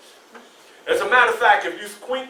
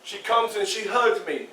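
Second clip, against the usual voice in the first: normal talking voice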